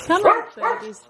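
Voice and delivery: high, loud call to birds; coaxing a wild bird. Real, not acted. Calling birds in.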